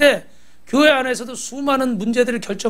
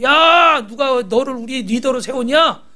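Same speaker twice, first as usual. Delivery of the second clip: resentful complaint; 3 semitones higher than usual. Retold or imitated. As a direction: act out an aggrieved crowd railing at its leader. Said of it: "또 막 원망하는 거예요".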